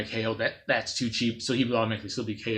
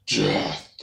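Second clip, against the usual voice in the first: a lightly voiced, unvoiced growled Death; menacing robot. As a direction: breathe the word like a cold killer. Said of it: "but he's like".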